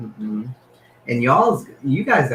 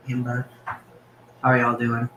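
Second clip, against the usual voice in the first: ordinary delivery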